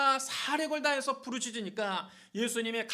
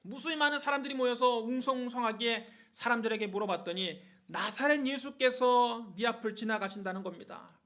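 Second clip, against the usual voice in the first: usual speaking voice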